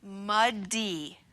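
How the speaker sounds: stretched muddy, the d held long; muddy blended slowly; slow blending. Shown as a demonstration. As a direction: draw muddy out slowly, sound by sound. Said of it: "All together".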